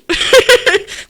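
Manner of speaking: high giggle; high laugh. Real, not acted. High laugh mid-quote.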